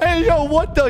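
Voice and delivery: high, loud startled yelp. Real, not acted. Streamer startled as players suddenly start fighting, high yelp. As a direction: jump at the sudden chaos.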